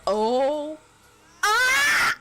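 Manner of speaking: sudden high startled scream; scared scream. Real, not acted. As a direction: shriek in real fright. Sudden hit in a tense fight.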